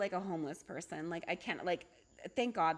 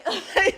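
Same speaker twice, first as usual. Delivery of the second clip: sudden loud laugh; laughing at her own tantrum; loud burst of laughter; 9 semitones higher, 10 dB louder than usual. Real, not acted. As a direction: break into a big laugh.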